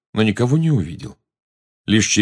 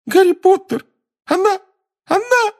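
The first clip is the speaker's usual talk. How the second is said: panicked squeaky elf; 4 dB louder than usual. Acted. Dobby panicking.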